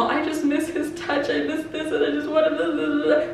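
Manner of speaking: high, whiny mock of her lovesick self; mocking sappy self. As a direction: parody your clingy past self, pitched high. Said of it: "some sappy, emotional".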